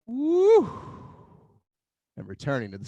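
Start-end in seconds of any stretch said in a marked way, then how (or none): 0.1-0.7 high, loud whoop of joy; loud excited whoop. Real, not acted. Instructor whooping as the class rises, loud and high. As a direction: shout a happy woo. Joyful cry during movement.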